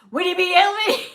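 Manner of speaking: high, loud gasp of recognition; excited recognition. Retold or imitated. Lady recognizing the YouTuber's name, thrilled and loud. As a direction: shriek the name like a thrilled fan.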